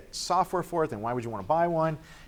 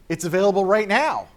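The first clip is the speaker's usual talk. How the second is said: louder, higher big reveal; dramatic announcement; 7 semitones higher, 8 dB louder than usual. Retold or imitated. Sega exec announcing launch on stage, loud reveal. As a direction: drop the bombshell on the crowd.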